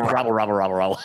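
playful mock Hamburglar robble. Doing the Hamburglar for friends, mock cartoon robble. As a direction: ham up the cartoon burger thief. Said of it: "very good hamburglar there".

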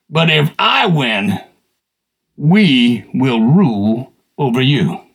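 low, slow menacing threat; giant's threat. Retold or imitated. The giant challenging Israel, low slow threat. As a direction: loom over them, slow and low. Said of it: "he yells at him and says".

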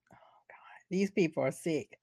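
whispered aside, no voice. Real, not acted. Friend whispering disgust at the partiers. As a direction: breathe it out in disgust. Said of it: "aside whispered".

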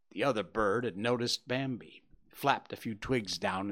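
ordinary delivery

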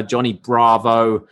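ordinary delivery

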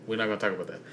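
ordinary talk, nothing marked

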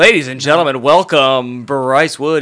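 loud, drawn-out stage introduction; mock announcer. Host hypes up a friend's impression, mock announcer. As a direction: announce the act to a big crowd.

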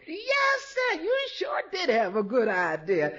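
normal talking voice

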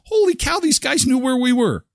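high, thrilled exclamation. Retold or imitated. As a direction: burst out delighted. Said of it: "I was just thrilled".

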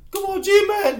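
high, loud cheer; hearty fan shout. Retold or imitated. Giant fans greeting these guys with pounds, shouted high and loud. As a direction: holler it out to a fellow fan.

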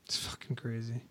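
whispered, breathless aside. Real, not acted. Cohost reacting to drug money, whispering. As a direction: drop to a whisper in disbelief.

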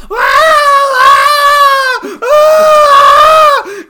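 piercing high screams, very loud; screaming like a terrified little kid; retold child screaming. Retelling a childhood movie fright, shrieking at the top of his lungs. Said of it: "I'm like this".

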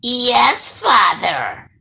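loud, high-starting creature voice; drooling troll daughter. A narrator's reading. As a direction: slobber it out with greedy relish. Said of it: "drooled the troll daughter".

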